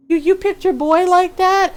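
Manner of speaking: imitating a friend's loud teasing disbelief. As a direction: tease them with pretend outrage. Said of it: "he started teasing me".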